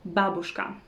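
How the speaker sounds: babushka stressed on the first syllable, BAbushka; correct stress. Shown as a demonstration. Teacher correcting the common mistake, stress on first syllable. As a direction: hit BA in babushka. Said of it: "stress is on the first syllable".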